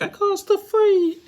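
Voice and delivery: mock crying. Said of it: "[name] started crying".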